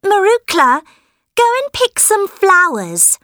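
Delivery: put-on high bossy demanding girl; spoiled girl voice. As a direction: order her around, high and spoiled. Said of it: "Helen said".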